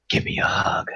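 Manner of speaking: whisper. Real, not acted. Asking the chat for a hug in a whisper. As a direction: whisper it like a joke.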